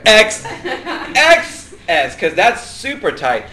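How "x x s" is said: laughing. Acted. Laughing joke about tight sizes.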